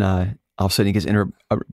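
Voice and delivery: ordinary delivery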